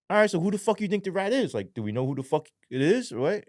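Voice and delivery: imitated innocent questioning; playing it off. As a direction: act as if you suspect nothing. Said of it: "I'm trying to play it off".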